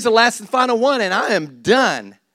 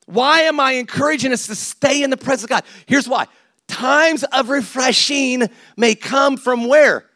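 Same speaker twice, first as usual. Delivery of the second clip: ordinary talk, nothing marked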